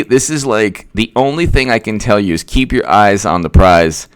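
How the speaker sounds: usual speaking voice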